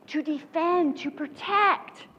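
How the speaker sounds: normal talking voice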